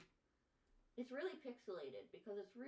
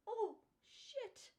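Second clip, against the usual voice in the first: much higher, panicked outcry; startled yelp. Real, not acted. Streamer panicking after closing her art by mistake. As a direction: squeak in sudden alarm.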